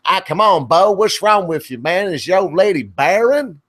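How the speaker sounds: put-on ribbing a buddy in a broad Kentucky accent; mocking Kentucky friend, high and loud; Kentucky drawl impression. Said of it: "a kind of drawback back into good old Kentucky".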